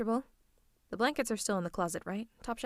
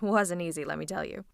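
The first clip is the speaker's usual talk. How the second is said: ordinary talk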